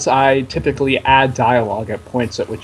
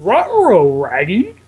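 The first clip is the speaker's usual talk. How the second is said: higher cartoon-dog voice, R on every word; Scooby-Doo; 5 semitones higher, slower than usual. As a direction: talk like a startled cartoon dog. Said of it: "how would Scooby-Doo say that".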